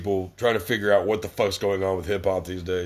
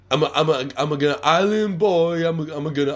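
dopey mock rapper chant, pitched higher; 6 semitones higher than usual. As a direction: parody their clip like a goofball. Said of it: "talking about how they're Island Boys".

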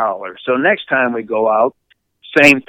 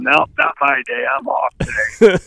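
higher-pitched talking-dog refusal; voiced refusing dog; 9 semitones higher than usual. Dog answering owner's command, high mock refusal. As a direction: play a dog declining to work.

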